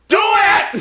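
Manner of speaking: high, very loud explosive urging; hammed-up yell. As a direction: yell it at the TV screen. Yelling at the TV, retold.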